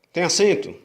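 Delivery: ordinary talk, nothing marked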